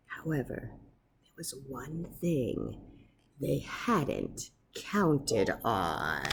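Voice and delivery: soft, low, slowing suspense; hushed storyteller suspense; ominous hush. A narrator's reading. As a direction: lean in with dread. Ominous twist.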